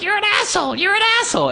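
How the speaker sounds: very high shrieked insult; high mock yell; kid yelling. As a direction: scream it like an outraged boy. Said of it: "throwing his hands up in the air like".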